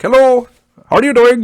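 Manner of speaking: imitating an Indian accent, high and loud; mock the Indian phone guy with an Indian accent. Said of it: "but with different accent".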